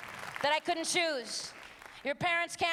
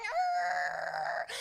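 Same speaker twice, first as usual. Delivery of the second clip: animal grunt; 10 semitones lower than usual. Pretend.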